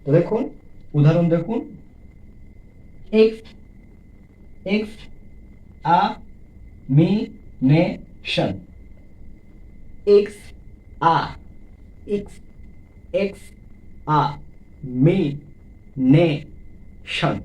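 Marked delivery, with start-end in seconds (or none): 3.2-8.5 x ... আ ... মি ... নে ... শন, very slowly; syllable by syllable. Shown as a demonstration. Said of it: "পাঁচটি". 10.2-17.4 restarts on x, then x ... আ ... মি ... নে ... শন; five isolated slow beats in examination; syllable by syllable. Shown as a demonstration. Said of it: "পাঁচটি".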